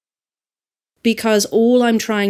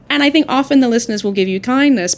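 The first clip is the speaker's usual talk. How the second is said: usual speaking voice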